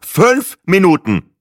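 slow impatient snort. Acted. Impatient tourist snorts at the farm wife, slow huff. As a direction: cut her off with a snort. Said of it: "schnaubte ich".

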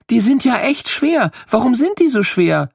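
high, lightly voiced child straining under a heavy jacket; little girl surprised. Girl trying on a fire jacket, surprised at how heavy. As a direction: marvel like a small girl at the weight. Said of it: "fragt Maike".

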